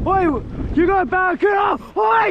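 high-pitched, urgent holler; excited calling out. Real, not acted. Angler spotting a barracuda on a mate's line, excited. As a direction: call it out to your mate in real excitement. Calling out friend's big fish.

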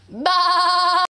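sheep's bleat as engine noise. Shown as a demonstration. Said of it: "turning the engine on".

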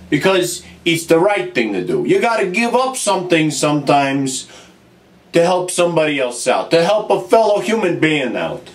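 low, measured, heartfelt; solemn and sincere. Acted. Plumber gravely addressing his brother Luigi, solemn and low.

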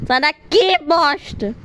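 usual speaking voice

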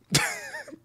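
sudden high-pitched giggle; real high laugh; high squeal laugh. Real, not acted. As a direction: squeal with delight. Laughing at own quote.

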